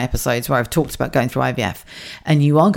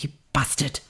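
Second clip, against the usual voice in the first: muttered thought; 9 dB quieter than usual. Retold or imitated. Private thought after husband's reply.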